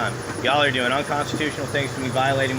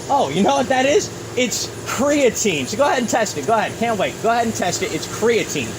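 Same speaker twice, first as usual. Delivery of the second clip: higher and louder, daring them to test it; angry defiant; 7 semitones higher, 3 dB louder than usual. Real, not acted. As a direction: go ahead, test it, shouted at the cops. Bag found, arguing with police.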